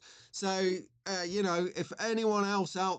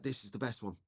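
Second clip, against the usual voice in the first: put-on hushed, reverent retelling of a kid; softer, awestruck imitation of the boy; hushed awe; 6 semitones lower, 7 dB quieter, faster than usual. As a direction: drop to a soft, awed near-whisper. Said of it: "he pulls it out ever so slowly".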